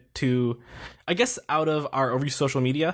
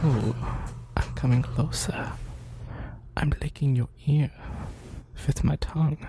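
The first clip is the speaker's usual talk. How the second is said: mock ASMR whisper.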